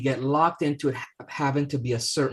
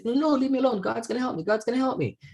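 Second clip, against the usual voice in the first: stubborn refusal; 11 semitones higher, faster than usual. Acted. Stubborn priest refuses rescue.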